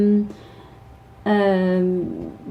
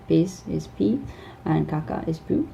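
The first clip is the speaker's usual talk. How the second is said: ordinary delivery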